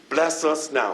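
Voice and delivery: normal talking voice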